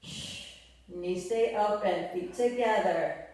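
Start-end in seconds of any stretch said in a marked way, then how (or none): 0.0-0.5 hissed breath out on the lift; sharp exhale. Shown as a demonstration. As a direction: exhale through the teeth. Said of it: "Exhale as you lift".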